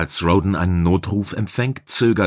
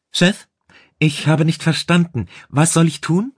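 put-on much higher, louder, uncertain young-helper voice; unsure technician; 9 semitones higher, 5 dB louder than usual. A narrator's reading. Technician outside the block asks the chief what to do, unsure. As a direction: pitch it way up, asking for instructions. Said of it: "fragte eine unsicher klingende, gedämpfte Stimme".

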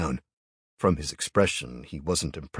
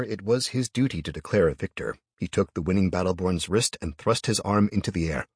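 usual speaking voice

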